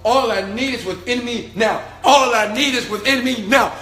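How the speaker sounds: from a firm chant rising to a loud high shout; building affirmation. Retold or imitated. Walking to the bus alone, chanting and building to a shout. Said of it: "I would walk".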